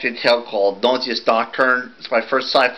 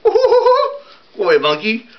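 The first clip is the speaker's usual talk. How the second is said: laughing squeal, much higher and very slow; squeaky shriek; 11 semitones higher, 4 dB louder, much slower than usual. Acted. Time traveller squeals at the monkey, laughing and very high.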